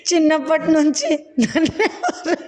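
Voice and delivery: half-laughing protest. Acted. Tickled man protests half-laughing.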